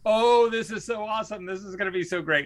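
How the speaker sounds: high gushing mock delight; the wife's friend raving about a test, high and gushing; excited gushing. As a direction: imitate her bubbling excitement. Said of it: "the way she said it was like".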